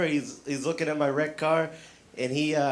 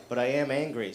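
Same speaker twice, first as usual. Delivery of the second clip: usual speaking voice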